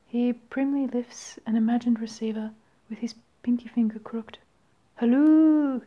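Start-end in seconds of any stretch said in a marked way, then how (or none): 5.0-5.8 raised, louder phone greeting; prim phone hello. Acted. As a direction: answer a pretend phone primly. Genie primly answers imagined phone.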